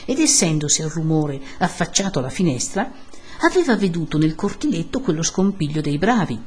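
ordinary delivery, nothing marked

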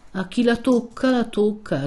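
lightly voiced simpleton with a silly smile; foolish smile. A narrator's reading. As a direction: repeat it through a foolish little smile. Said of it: "replicò quello con un certo sorriso sciocco".

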